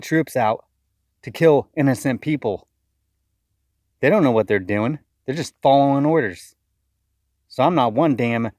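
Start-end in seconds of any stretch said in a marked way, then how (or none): none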